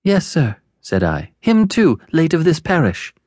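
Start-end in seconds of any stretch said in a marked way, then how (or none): 0.0-0.6 put-on very high, timid child; high-pitched nervous reply; scared little boy. Timid boy answers convict. 1.4-3.1 put-on very high, timid child; high-pitched nervous reply; scared little boy. Timid boy answers convict.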